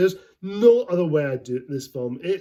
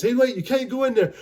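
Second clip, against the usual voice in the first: higher, urgent call to stop someone; raised-pitch mock alarm; acted guard's warning; 9 semitones higher than usual. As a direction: call after someone barging through. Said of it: "this guy says".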